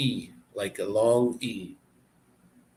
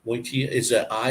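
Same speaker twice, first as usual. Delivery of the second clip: ordinary talk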